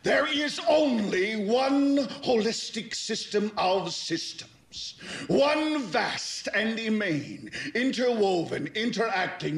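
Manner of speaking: high, ringing, rhythmic litany; impassioned oration. Acted. Preaching the one system of dollars to Beale, impassioned oration. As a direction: pound out the list like a zealous orator. Sweeping tirade on world money.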